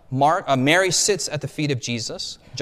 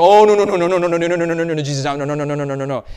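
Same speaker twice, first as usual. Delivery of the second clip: frantic protest; 3 semitones higher, 9 dB louder, much faster than usual. Acted. Disciples protesting as Jesus kneels to wash their feet, loud frantic no. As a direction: panic at the master kneeling, then calm down.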